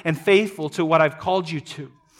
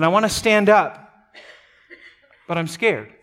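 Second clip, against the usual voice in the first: laughing through it. Retold or imitated. Retold inner panic, laugh heard.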